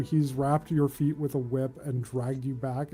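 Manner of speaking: ordinary talk, nothing marked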